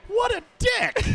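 loud, high outraged yell; incredulous shout. Retold or imitated. Fan yelling at the player who snubbed him. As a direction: blurt it out in disbelief.

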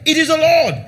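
high, loud exclamation of recognition; excited cry. Retold or imitated. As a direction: call out in joyful discovery. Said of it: "Said to Peter".

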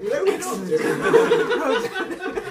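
high, loud, slow mocking squeal; pinched voice. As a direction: squeal it out in mock denial. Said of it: "just with them going".

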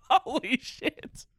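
slow, high laughing exclamation. Real, not acted. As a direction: laugh it out in disbelief. Laughing at own joke.

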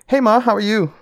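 high, innocent phone greeting; feigned cheerfulness. Retold or imitated. Son answering mom's early call, high feigned innocence. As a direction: pitch it up like nothing is wrong.

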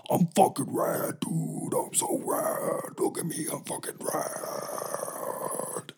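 raspy unvoiced stoner whisper, slow; monster monster. Monster monster bragging to the mustard monster in a raspy whisper. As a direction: breathe it out like a burned-out dude. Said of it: "the monster monster's like".

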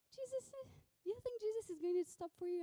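dismissive, incredulous jeer; scoffing. Acted. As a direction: scoff at someone's silly hope. Crowd scoffs at the beggar.

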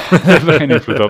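laughing. Real, not acted. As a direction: talk right through a real laugh. Shared laugh after a remark.

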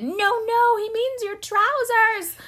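high, urgent mock shout; parents' shout. Parents stopping their young son at the gate, high-pitched shout. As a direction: call out loudly, stretching the words. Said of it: "my parents immediately shouted".

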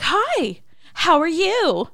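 high, loud gushing hello; over-enthusiastic greeting. Retold or imitated. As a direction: imitate an overeager fan, way too much. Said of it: "tone it down a little bit".

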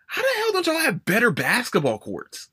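ordinary talk

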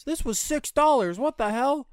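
loud, high-pitched imitation of indignation; retold outrage. Retold customer outraged at price.

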